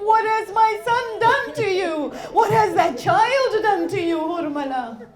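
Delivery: loud, fervent sermon delivery; impassioned preaching. Acted. Preacher voicing Imam and mother at Karbala, loud. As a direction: push every line with grief.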